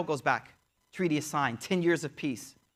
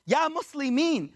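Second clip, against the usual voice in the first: high-pitched pleading outcry, not truly shouted; imitated cry of protest; mild mock scream; 6 semitones higher than usual. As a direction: retell a scream with raised pitch only. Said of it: "He starts screaming".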